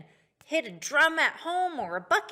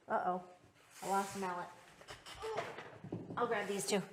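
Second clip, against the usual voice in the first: ordinary talk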